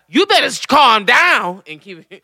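high, sassy telling-off. Retold or imitated. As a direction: scold the spirit, slowing down hard on the command.

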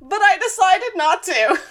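high, loud, laughing payoff; gleeful confession. Real, not acted. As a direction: confess it through real laughter. Gleeful payoff of toy story.

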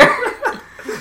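high giggle. Real, not acted. Laugh after quote.

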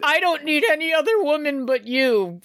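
high, mock-romantic skater voice; lovesick skater. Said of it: "he's like".

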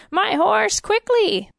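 high, excited command; urgent cry. A narrator's reading. King calling for his horse, crying urgently. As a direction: call it out high and impatient. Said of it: "he cried".

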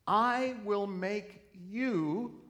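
"I will make you" high, loud and stretched; scripture read with weight; slow emphatic quote. Shown as a demonstration. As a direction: lay out each word of the promise. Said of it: "quoting Isaiah, slow emphasis".

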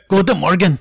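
loud, high mock German greeting; yelled German accent. Shown as a demonstration. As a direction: shout it in a caricature German accent. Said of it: "German accent and not be like".

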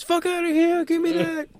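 snatch the trophy with a yell; Melo's gloat. Pretend. Melo grabbing the trophy after the win, gloating yell. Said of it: "And then Melo goes".

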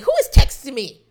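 irritated high, loud yelling; exasperated outburst. Acted. Griping at whoever sends phone notifications, loud exasperated outburst. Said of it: "that's what I sound like all day".

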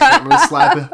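laughing through the words; giggling while joking. Real, not acted.

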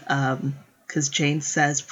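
usual speaking voice

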